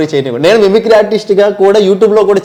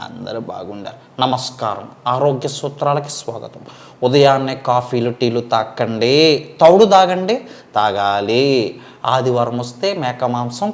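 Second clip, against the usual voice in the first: health guru; 5 semitones lower, 5 dB quieter than usual. Retold or imitated. Health-tips speaker impression.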